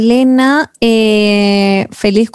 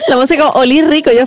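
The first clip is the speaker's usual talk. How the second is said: ordinary delivery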